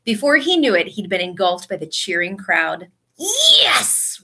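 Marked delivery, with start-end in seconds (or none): 3.2-4.2 high-pitched victory cheer; triumphant yell. A narrator's reading. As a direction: yell it in celebration. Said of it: "Ron yelled".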